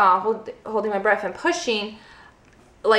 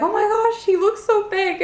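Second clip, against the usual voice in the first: amazed; 11 semitones higher than usual. Real, not acted. First sight of newborn son.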